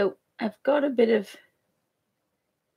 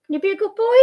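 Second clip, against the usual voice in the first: dog talk; 10 semitones higher, 3 dB louder, much faster than usual. Real, not acted. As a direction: coax the puppy sweetly. Talking to dog, baby voice.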